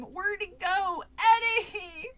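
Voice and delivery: high, worried calling; frightened call. A narrator's reading. Sister searching for her lost brother, frightened calling. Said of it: "My voice grew higher and more frightened".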